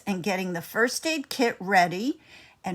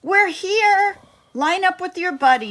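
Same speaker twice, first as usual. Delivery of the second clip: much higher, louder, fast teacher call; teacher Miss Maxwell calling; 11 semitones higher, 7 dB louder than usual. A narrator's reading. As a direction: round up the class brightly. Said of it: "Miss Maxwell said".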